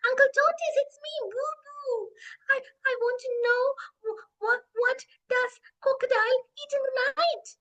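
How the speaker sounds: high, excited little voice with halting repeats; eager child elephant. Acted. Boo Boo waking Uncle Tortoise with a question, eager child voice. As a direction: pipe up loud and high, full of curiosity.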